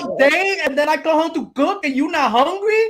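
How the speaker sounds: put-on high, loud, indignant Spanish-accented wife; Spanish woman voice. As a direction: scold your husband as a Spanish-accented lady. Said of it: "I chose that Spanish woman voice".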